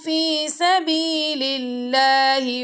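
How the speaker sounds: slow, melodic tajweed recitation; Quran recitation. Shown as a demonstration. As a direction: chant the verse slowly and evenly, teaching by example. Teacher recites Quran verse.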